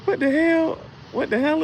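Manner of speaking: high-pitched stunned what the hell; a sudden high yelp of confusion; shocked. Retold or imitated. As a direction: squeak it out in disbelief. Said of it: "a moment I was like".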